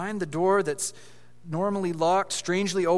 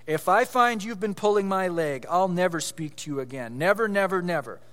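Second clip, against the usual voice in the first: usual speaking voice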